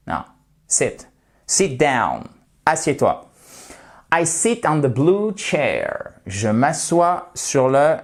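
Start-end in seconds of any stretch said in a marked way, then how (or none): 4.1-6.1 "sit" said slowly with a short i; slow model sentence; slow English demo. Shown as a demonstration. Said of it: "exemple d'anglais". (phrase d'exemple lente et appuyée)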